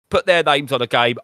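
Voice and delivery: ordinary talk, nothing marked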